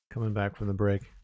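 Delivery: normal talking voice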